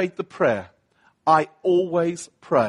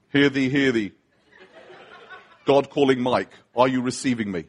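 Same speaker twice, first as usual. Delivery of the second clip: mock divine announcement; 6 semitones lower than usual. Mock voice of God calling by radio.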